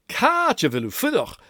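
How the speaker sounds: lively Gaelic "Catch a veil u fudach". Shown as a demonstration. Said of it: "listen to the difference".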